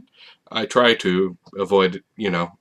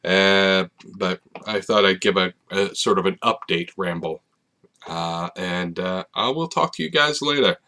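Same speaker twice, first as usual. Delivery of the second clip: normal talking voice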